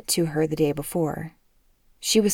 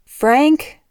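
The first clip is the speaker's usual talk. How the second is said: loud, high yell for her husband; 9 semitones higher, 9 dB louder than usual. A narrator's reading. Mother yells for the father to come upstairs, loud high yell. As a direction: yell his name through the house. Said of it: "she yelled".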